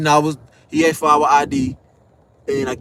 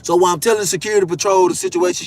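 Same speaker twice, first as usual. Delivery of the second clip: normal talking voice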